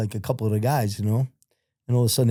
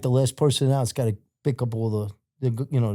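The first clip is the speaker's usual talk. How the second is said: usual speaking voice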